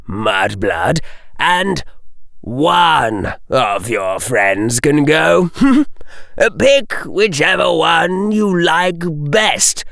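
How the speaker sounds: slow, toying menace with a small laugh; menacing. A narrator's reading. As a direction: taunt them, stretching the words out. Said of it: "and with menace".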